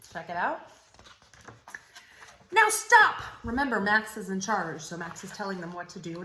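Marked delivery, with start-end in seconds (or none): 2.5-3.2 Max's high, loud order; a little king yelling stop; commanding shout. Acted. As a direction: Max ordering the wild things to stop. Max commanding wild things.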